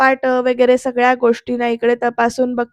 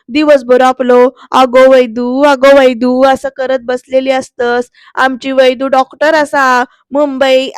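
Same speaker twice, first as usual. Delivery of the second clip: loud, slow mock imitation of the doting aunt; mimicking the aunt; 7 dB louder, slower than usual. Surya mimics the aunt fussing over Vaidehi, loud mockery. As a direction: parody her fussing call. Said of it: "असं करून ना joke करतो".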